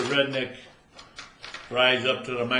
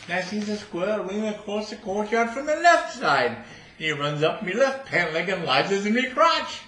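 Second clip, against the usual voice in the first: higher, louder guard voice; soldier's testimony; 7 semitones higher, 4 dB louder than usual. Acted. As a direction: insist it wasn't then. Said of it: "He says".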